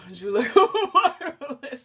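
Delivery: high, loud, slow laughing drawl; laughing Billy Crystal impression. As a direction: ham it up like a showbiz host. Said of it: "To quote Billy Crystal".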